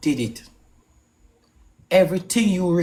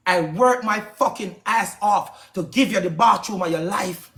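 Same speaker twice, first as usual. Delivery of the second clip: usual speaking voice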